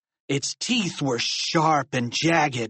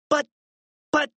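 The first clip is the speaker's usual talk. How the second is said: short, halting false starts; flustered broken protest; stammering. A narrator's reading. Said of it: "Harold stammered".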